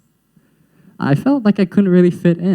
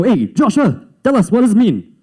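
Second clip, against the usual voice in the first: lightly voiced teacher, higher pitch; calling-on teacher; 7 semitones higher than usual. Teacher asking the class dictionary, higher and demanding. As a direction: imitate a teacher putting a pupil on the spot.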